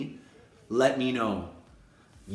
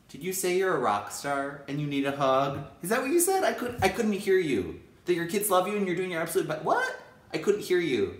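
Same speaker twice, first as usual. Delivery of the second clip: playful mock mishearing; 7 semitones higher than usual.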